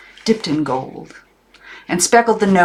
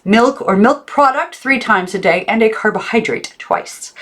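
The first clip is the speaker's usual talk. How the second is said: high, loud rule recital; stern aunt; 7 semitones higher, 3 dB louder than usual. A narrator's reading. Aunt reciting diet rules to Maggie, high and stern. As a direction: rattle off rules like a strict aunt. Aunt voiced in read story.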